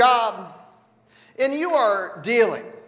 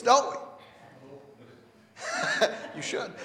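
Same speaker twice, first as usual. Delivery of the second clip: normal talking voice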